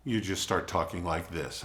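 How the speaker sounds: relaxed, grounded speaker voice; calm confident voice. Shown as a demonstration. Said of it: "a lot more confidence and strength in your voice".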